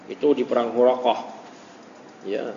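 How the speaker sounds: usual speaking voice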